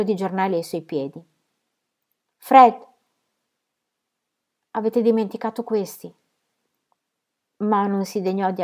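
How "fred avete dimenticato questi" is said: loud high call trailing off; calling after someone. A narrator's reading. Lucy calling after Fred as he walks out. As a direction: call out after him as he leaves.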